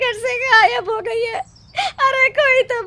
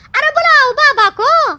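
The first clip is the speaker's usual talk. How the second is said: higher, louder shout for the baba; raised voice calling across the village; 6 semitones higher, 6 dB louder than usual. Acted. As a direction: summon the holy man, loudly.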